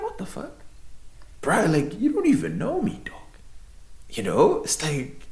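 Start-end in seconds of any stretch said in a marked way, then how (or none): 0.0-3.2 high-pitched, incredulous retelling; shocked disbelief. Retold or imitated. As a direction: jump high in baffled protest. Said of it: "I was honestly shocked".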